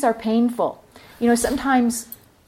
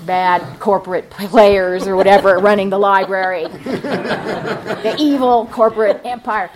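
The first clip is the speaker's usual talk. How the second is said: mock ominous; 6 dB louder than usual. Mocking the man's grievance.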